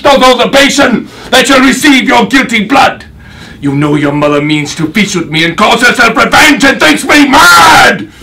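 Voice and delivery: high, loud rage climbing to a scream; unhinged, full-throated vengeful tirade; losing it. Acted. Avenging father threatens the villains with slaughter, losing all control. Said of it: "when you lose your shit, like you just did".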